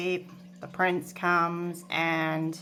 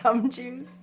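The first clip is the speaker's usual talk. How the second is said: laughing; 6 semitones higher, 4 dB louder than usual. Real, not acted. Laughing at her own gum joke.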